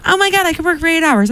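high, fast, breathless mock hyper voice. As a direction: play a wired, overexcited user, rushing it. Imitating hyper stimulant user.

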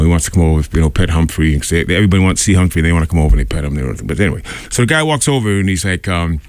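ordinary talk, nothing marked

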